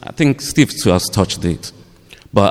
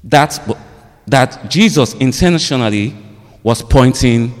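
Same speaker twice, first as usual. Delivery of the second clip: higher-pitched, deliberate preaching with long pauses; emphatic sermon delivery; 4 semitones higher than usual. Real, not acted. Preacher puts searching questions to the congregation, emphatic sermon delivery. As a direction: lift each phrase and let it land.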